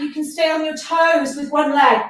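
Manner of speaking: normal talking voice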